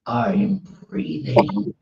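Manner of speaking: slow, strained mock growl; imitated laboring wife. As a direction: drag each word out, imitating pained exasperation. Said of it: "She goes".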